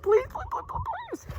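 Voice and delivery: high, whiny pleading please; begging. Shown as a demonstration. Teacher showing the class how a child implores, whiny begging. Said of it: "implore means you really beg someone".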